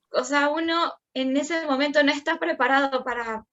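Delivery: usual speaking voice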